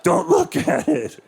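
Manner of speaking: very high, strained creep voice; slow, drawn-out high-pitched menace; creepy serial killer. Acted. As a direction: order a victim around like a basement killer. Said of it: "a dude like my size going".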